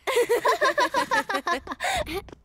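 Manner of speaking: high, gleeful mocking laugh. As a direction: laugh at someone's misfortune. Mocking the quarrel.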